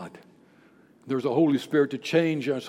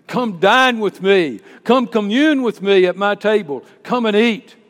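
loud, building preacher's summons; proclaimed invitation; 5 semitones higher, 13 dB louder than usual. Acted. God inviting sinners to his table, loud preached invitation. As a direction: call them in, each invitation bigger.

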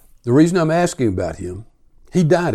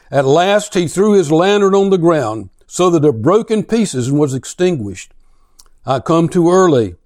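a raised, declaiming reading voice; dramatic reading; 3 semitones higher, 4 dB louder than usual. Acted. Preacher reads The Madman to his congregation, dramatic reading. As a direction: read it like a tale of a madman.

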